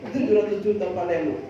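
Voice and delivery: normal talking voice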